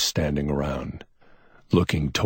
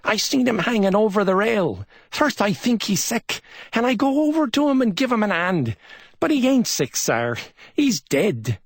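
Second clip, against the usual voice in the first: high-pitched Barbados British accent, shaken; a waiter reporting in a Barbadian British accent; 4 dB louder than usual. A narrator's reading. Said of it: "the unexpected British accent of the Barbados Negro".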